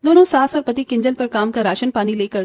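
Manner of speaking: ordinary delivery, nothing marked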